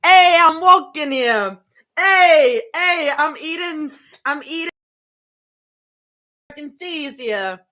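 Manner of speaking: hammed-up New York accent, self-admittedly not good. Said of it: "I don't have a good New York accent".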